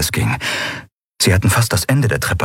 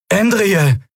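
higher echoing call from afar; 12 semitones higher than usual. Acted. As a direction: call her name across the stairwell. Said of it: "hallte eine bekannte Stimme". (hallender Ruf)

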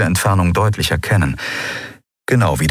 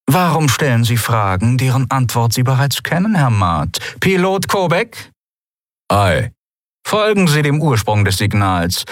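a hint of a commanding captain, pitched up; lightly voiced commander, raised pitch; cool commander, mocking; 5 semitones higher than usual. A narrator's reading. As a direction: give orders with light mockery. Commander gives orders to crew.

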